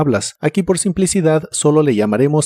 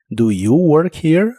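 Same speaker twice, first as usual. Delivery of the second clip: slow, clear question, rising on "here"; careful model; slower than usual. Shown as a demonstration. Teacher giving learners the correct question, slowly and clearly. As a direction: lay out "Do you work here?" slowly, lifting on "here". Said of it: "Ahora sí es una pregunta clara y correcta".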